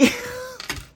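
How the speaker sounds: very high squealing laugh; tickled laugh. Real, not acted. Reader cracking up at her own Toronto impression, real squealing laugh. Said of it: "that tickled me so much".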